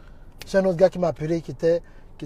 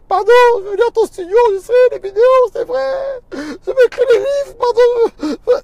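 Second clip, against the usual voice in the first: high, loud, fast crying apology; rushed tearful plea; 12 dB louder than usual. Retold or imitated. A crying caller promises the pastor he will write books. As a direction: gush out promises through sobs.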